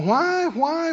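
normal talking voice

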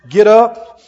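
ordinary talk

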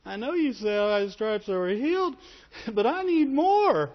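mock whiny believer. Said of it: "You just said it with a different voice".

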